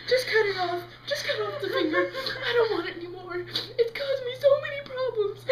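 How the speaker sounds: high, tearful mock begging; wailing plea. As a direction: plead hysterically through fake sobs. Begging for finger to be cut.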